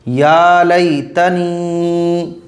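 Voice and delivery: या लैतनी stretched out very slowly; very slow model reading. Shown as a demonstration. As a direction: drag out each syllable for students. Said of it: "समझ रहे हैं बात को?". (या लैतनी को बहुत धीरे, हर अक्षर खींचकर)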